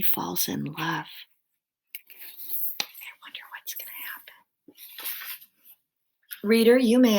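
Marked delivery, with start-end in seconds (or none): 2.8-4.3 quick, whispered wondering; hushed aside. Real, not acted. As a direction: whisper it like a teaser. Wondering aloud before next part.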